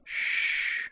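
gentle whispered shh to calm a frightened lover; murmured shush. A narrator's reading. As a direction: hush him in a whisper. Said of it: "Azalin murmured".

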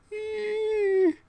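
very high frightened squeak; cowering Wayne squeaking in fear; terrified squeak. Acted. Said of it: "said Wayne".

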